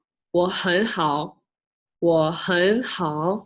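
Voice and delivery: "我很好" said slowly, repeated; slow Mandarin demo. Shown as a demonstration. Teaching how to answer I'm good, slow Mandarin. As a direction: say "我很好" slowly twice for learners. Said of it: "you could say".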